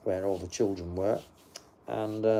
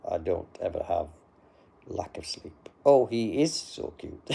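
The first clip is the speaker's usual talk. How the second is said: ordinary delivery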